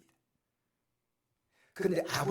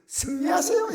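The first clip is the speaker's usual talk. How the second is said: a much higher, lifted farewell call; cheerful send-off; 14 semitones higher, 8 dB louder than usual. Retold or imitated. Doctor calling out to the departing preacher, high and cheerful. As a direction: chirp the blessing after him as he leaves. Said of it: "그래서 뒤에서 이래요".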